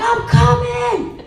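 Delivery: high, slow, loud call back; dad calling back. Retold or imitated. Dad answering his daughters across the waves, calling loudly. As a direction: call out that help is on the way. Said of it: "He's like".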